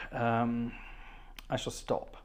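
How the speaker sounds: ordinary talk, nothing marked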